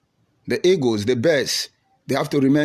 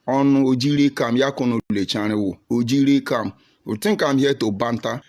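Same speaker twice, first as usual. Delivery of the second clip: ordinary delivery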